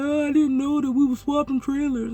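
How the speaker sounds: high mock voice for the other driver; put-on driver voice. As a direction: plead ignorance in his silly high voice. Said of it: "using that voice for his voice".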